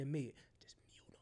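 soft whispered aside to the producer; secretive whisper. Retold or imitated. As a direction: whisper it so the caller can't hear. Secretly instructing producer while caller holds.

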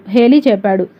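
plain reading voice

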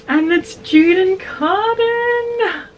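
high, slow, delighted cry; excited squeal. Real, not acted. Opening the box for the viewers, delighted squeal. Gasps at blanket design.

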